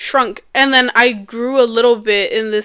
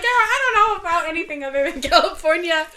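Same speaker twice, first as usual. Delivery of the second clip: higher-pitched mock protest; acted quote; 5 semitones higher, 4 dB quieter than usual. Co-host playing someone clueless about other states, high mock protest. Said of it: "it's supposed to like".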